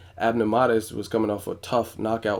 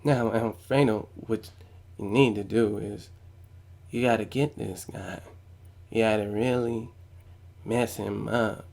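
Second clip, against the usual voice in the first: mock calm corner advice, soft and unhurried; cool, collected coach talking quietly, no urgency; too calm and collected; 5 dB quieter than usual. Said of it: "he's just too calm and, and cool, collected".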